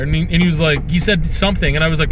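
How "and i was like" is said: ordinary delivery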